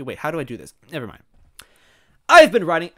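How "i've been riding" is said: loud, high character outburst; forceful raised-voice protest; crying out. Acted. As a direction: cry it out in indignation. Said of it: "Wendy cried out".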